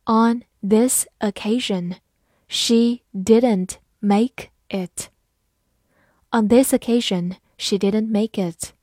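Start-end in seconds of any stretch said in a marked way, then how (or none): 0.0-5.1 slow, even reading pace; careful slow model reading. Shown as a demonstration. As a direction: read it slowly word by word. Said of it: "好，慢慢来读。".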